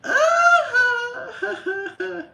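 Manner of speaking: loud, very high laugh; high squealing laugh. Real, not acted. To viewers about her house, high squealing laugh.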